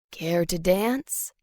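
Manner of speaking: low, soft, slow purring male voice; a suave stranger's deep, unhurried purr; rich, sonorous man. A narrator's reading. Mystery man inviting her to dance, rich and sonorous. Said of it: "His voice is rich and sonorous".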